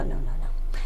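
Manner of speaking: breathy whispered refusal. Real, not acted. Whispering no to pulp in orange juice. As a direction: hush it out, laughing it off.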